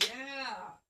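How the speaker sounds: put-on high eager children's reply; kids answering. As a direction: chime in like a whole class of kids. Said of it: "And the kids".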